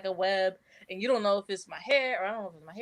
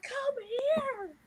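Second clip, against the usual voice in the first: high call to a dog. Retold or imitated. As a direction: call him over, pitched up high. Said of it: "and I'm like".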